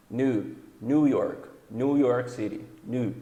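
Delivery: "new" said "nu", slowed; American new. Shown as a demonstration. Teacher modelling American "New York", slowly. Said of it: "O inglês americano é U".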